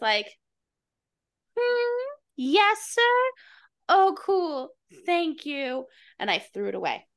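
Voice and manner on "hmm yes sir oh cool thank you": high, bright fake-polite voice; chirpy brush-off to a stranger; mock sweet thanks to the man in the car.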